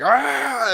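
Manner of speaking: high frustrated mock cry; mom's exasperated yell. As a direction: throw out a fed-up ah. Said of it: "my mom's like".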